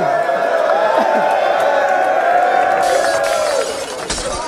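long high yelling whoop; high shouted whoop. Real, not acted. Crowd member hyping the punchline with a high whoop. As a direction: let out a huge high whoop.

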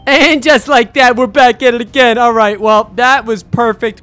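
big, high, loud announcer-like opening; loud, triumphant video intro; loud announcing. Real, not acted. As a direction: boom it out with drawn-out words. Announces the return after a round.

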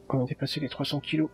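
ordinary talk, nothing marked

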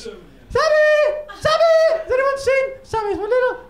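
put-on high, far-off child calling for her dog; little girl calling. Little girl calling across the park for her lost dog. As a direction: call out like a small girl searching for her pet.

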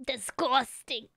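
low, slow, soft revulsion; disgusted. Pretend. Reacting to the first sip of garlic, disgusted. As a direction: drag it out in disgust.

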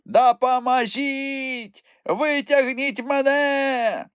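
a dangling troll begging for rescue; wailing for help. A narrator's reading. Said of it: "голосіння мумітроля". (протяжне голосіння)

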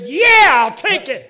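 high, very loud re-enacted whoop; triumphant cheer. Preacher cheers on being told he's ready for war, triumphant. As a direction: burst out in a fist-pumping yell. Acts out fist-pumping cheer.

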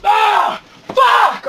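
high, loud mock-anguished shout; acted scream of frustration; frustrated outburst. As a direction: yell it out in hammed-up despair. Acts outburst at pops being sold out.

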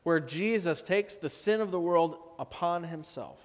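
ordinary delivery

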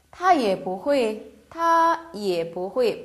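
each syllable spelled out slowly; slow model reading. Shown as a demonstration. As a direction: read it slowly for learners. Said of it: "반말하죠?". (천천히 또박또박 읽는 예문)

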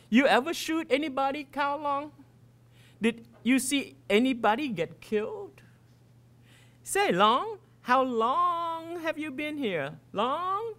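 high, jeering schoolkid voices; taunting kids. Acted. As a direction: needle the new kid mockingly. Losers taunting the new kid.

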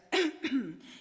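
a loud, rough throat clear. A narrator's reading. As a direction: clear your throat hard, like a cough. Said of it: "he coughed and he whiffed".

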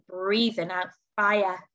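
ordinary delivery

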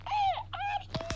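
very high, loud, drawn-out mock greeting; imitating stream viewers. Gamer mimicking everyone on the stream, mock excited greeting. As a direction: squeal it slowly like an over-eager fan. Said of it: "everyone on your stream bloody saying".

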